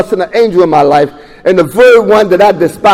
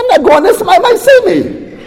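higher, mock-horrified through a laugh; laughing refusal; 9 semitones higher than usual. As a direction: laugh off the idea of going. Refusing NA meeting, retold with laugh.

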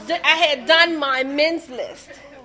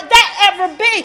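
ordinary talk

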